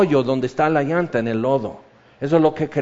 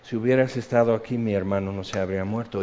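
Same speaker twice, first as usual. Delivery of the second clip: lower, softer, quick hush; tender, hushed; 6 semitones lower, 6 dB quieter, faster than usual. Retold or imitated. María a los pies de Jesús, hushed and tender. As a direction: murmur it at his feet, broken. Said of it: "ella está a los pies de Jesús".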